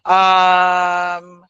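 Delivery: long drawn-out "um", then "you know"; hesitant filler demo. Shown as a demonstration. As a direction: stall on a held, level "um". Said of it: "yung mga fillers na sinasabi".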